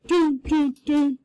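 loud, clipped mock-angry syllables; mock angry stomping. Teacher imitating an angry girl marching away, mock angry. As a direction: act out storming off in a huff. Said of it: "when somebody's mad".

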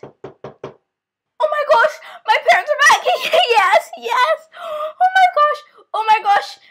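high, thrilled exclamations; excited relief. Acted. Child sees that the parents are back, excited relief. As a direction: squeal with joy at the rescue.

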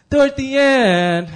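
high, loud, stretched-out name; mock scolding call. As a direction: call the name out like exasperated classmates. Classmates' scolding catchphrase call.

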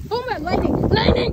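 real startled exclamation. Real, not acted. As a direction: react to the flash. Startled by lightning.

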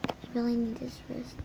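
hushed, murmured to self; quiet. Real, not acted. Repeats aside much softer.

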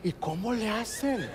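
soft, slow, feigned-curious ask; hushed slow mock-curious question; mock innocent question. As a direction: ask it sweetly and slowly, playing dumb. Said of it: "Y yo".

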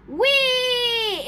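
long, high whee; gleeful monster squeal; screeched "whee". A narrator's reading. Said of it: "it screeched".

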